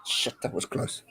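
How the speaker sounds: breathless whisper, voice almost gone; whispered relief at a near miss; whispered curse. Real, not acted. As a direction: hush it like the try nearly went in.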